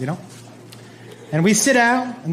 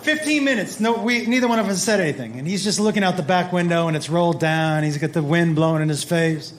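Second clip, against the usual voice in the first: normal talking voice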